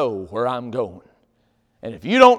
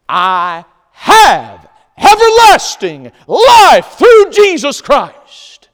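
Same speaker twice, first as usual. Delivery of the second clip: slow, loud, high proclaimed shout; preaching shout; 10 semitones higher, 14 dB louder, much slower than usual. Real, not acted. Preacher shouting the promise to the congregation, slow and loud. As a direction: thunder it out to the congregation.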